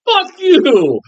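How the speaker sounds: high, indignant retort; exasperated outburst. Retold or imitated. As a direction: throw it at the movie in outrage. Angry retort to bloodless film.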